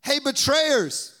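high, loud, slow mocking hail; mock accusing call. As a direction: sarcastically call them out. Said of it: "he didn't say".

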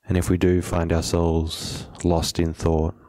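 unhurried meditation guide, long pauses between phrases; measured, settled meditation instruction; guided meditation. Acted. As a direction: calmly guide them to settle, leaving space after each phrase.

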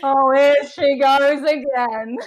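slow laughing tease. Real, not acted. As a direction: tease her while laughing. Teasing friend for yawning.

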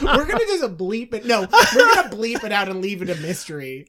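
cracked up, insisting on covering up an embarrassment; laughing refusal. Real, not acted. Podcaster refusing to air their botched French accent, laughing in protest.

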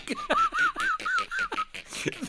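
very high, squealing laughter; helpless laughing fit. Real, not acted. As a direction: lose it completely.

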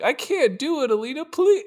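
squeaky high-pitched plea, hammed up; mock a helpless girl begging in a very high voice; high whiny damsel. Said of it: "Barbie is like".

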